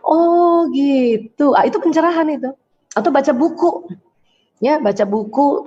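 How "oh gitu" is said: long drawn-out slow "Oh gitu" rising; a very slow, amazed "Oh gitu"; dawning realization. Shown as a demonstration. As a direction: let the understanding dawn slowly. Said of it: "Ke pencerahan tuh gini".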